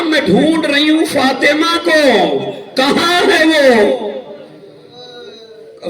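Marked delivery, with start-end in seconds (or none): 0.0-4.1 high searching cry. Retold or imitated. Daughter searching for Fatima.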